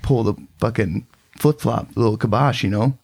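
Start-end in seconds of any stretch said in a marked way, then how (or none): none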